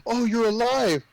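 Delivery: high, mock-astonished exclamation; surprised relief. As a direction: act out a delighted discovery. Retold relieved discovery of living friend.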